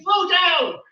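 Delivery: high, loud shouted commands, building to a final yell; screaming voice of reason. Acted. Voice of reason screams at the stumbling tongue, shouted. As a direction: scream it as escalating orders. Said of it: "the voice of reason screams".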